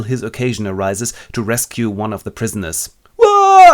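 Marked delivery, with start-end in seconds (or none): 3.2-3.7 very high, loud whoa; a shocked yelp; startled whoa. Acted. Robinson firing on the cannibals, high loud yelp.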